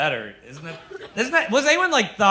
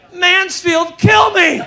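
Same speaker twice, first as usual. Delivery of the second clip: much higher, loud comic scream; newborn screaming; 13 semitones higher, 10 dB louder, much slower than usual. Retold or imitated. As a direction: shriek it like a newborn begging. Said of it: "the baby comes out like".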